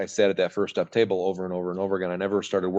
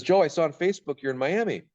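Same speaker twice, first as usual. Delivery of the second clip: higher, lightly voiced friend on the phone; voicing a friend; 8 semitones higher than usual. Friend Mark phoning the speaker, lightly voiced and higher. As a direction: play the friend calling out of nowhere, a bit higher.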